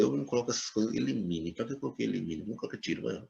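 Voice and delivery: very quiet, under-the-breath self-questioning; muttered aside. Real, not acted. Teacher questions their own written wording, muttered aside. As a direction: grumble softly to yourself, well below lesson volume.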